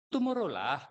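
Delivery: "tomorrow lah" in Singaporean English; Singaporean accent. Retold or imitated. Judge showing local flavour in Singapore English, imitated Singaporean accent. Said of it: "Bahkan di Singapura".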